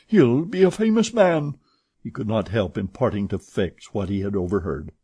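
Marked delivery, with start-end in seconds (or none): 0.1-1.6 muttered hope. A narrator's reading. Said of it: "muttered he".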